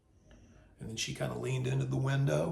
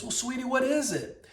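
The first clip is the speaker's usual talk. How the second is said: high, sweet Southern server; Southern lady; 9 semitones higher than usual. Retold or imitated. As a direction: ask it like an unbothered Southern sweetie, pitched high. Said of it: "saunters back over there real slowly and says".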